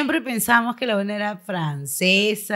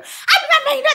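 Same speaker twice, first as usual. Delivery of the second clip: high laughing squeal, much higher; laughing through pain; 3 dB louder, much faster than usual. Retold or imitated. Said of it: "lo decía muy rápido".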